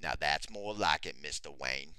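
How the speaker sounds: Morgan Freeman impression. Podcast host introducing the show as Morgan Freeman, low mock narrator. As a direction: drop low and play the wise old narrator. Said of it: "I'm Morgan Freeman".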